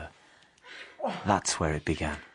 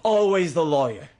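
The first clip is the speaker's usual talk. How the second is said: sharp retort; 9 semitones higher, 8 dB louder than usual. Acted. Bitter jab at the lawyer.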